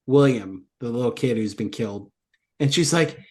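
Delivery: ordinary talk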